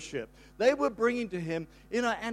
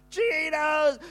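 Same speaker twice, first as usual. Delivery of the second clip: put-on excited kids' shout; 7 semitones higher, 5 dB louder than usual. Grandkids burst in shouting.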